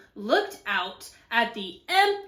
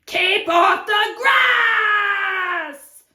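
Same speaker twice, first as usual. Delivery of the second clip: high, very drawn-out loud yell; bellow, stretching the last word; angry shout; 7 semitones higher, 8 dB louder, much slower than usual. Acted. Cactus to passing cowboy, shouting. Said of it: "shouted Hank".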